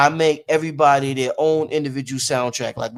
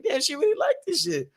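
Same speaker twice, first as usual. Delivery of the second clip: put-on child's amazement; 12 semitones higher, 6 dB quieter than usual. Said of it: "I'm like".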